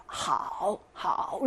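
하오 stretched low, dipping then rising; long third tone. Shown as a demonstration. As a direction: draw out 하오 through the full dip and rise. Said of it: "이렇게 길잖아요".

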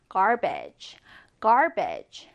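garbage twice, carefully; model pronunciation. Shown as a demonstration. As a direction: demonstrate garbage. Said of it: "garbage 발음해 보겠습니다". (garbage 발음 시범)

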